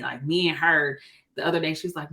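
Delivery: usual speaking voice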